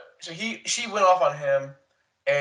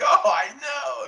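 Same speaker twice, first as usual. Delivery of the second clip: drawn-out, high mock wail; mock crying wail; 11 semitones higher, 4 dB louder, much slower than usual. Family mourning grandma on the show, mocked as a high slow wail. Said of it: "and they're like".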